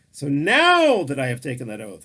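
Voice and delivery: "now" pressed hard in "So now that I have taken that oath"; stress on now. Shown as a demonstration. Preacher stressing "now" to his listeners, emphatic. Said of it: "emphasize the word now".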